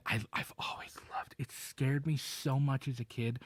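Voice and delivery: hushed whisper; soft breathy confession. Real, not acted. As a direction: whisper it like a secret fear. Hushed aside about childhood fear.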